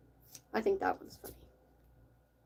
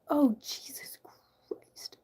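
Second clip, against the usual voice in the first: whispered exclamation; 4 semitones higher, much slower than usual. Real, not acted. Startled under-breath exclamation.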